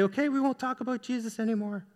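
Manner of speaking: small, high, soft mock-timid voice; imitating someone cowed into giving up; shying away. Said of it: "didn't shy away and say".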